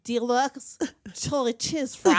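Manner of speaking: put-on slow French-accented "Deluxe chili cheese fry". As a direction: mimic a struggling French speaker. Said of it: "this is how I hear it".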